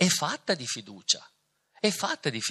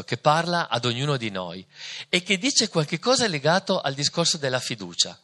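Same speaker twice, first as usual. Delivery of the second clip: ordinary delivery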